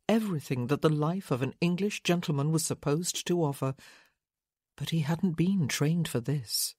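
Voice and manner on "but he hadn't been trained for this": ordinary delivery, nothing marked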